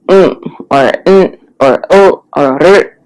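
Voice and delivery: each sound cut short with a little pop; mm, oh and r stopped abruptly in the throat; glottal cutoff. Shown as a demonstration. Teacher demonstrating glottalized sonorants to learners, cut off short. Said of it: "gives it that little cutoff".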